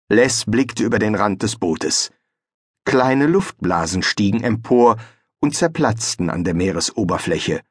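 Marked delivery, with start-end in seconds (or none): none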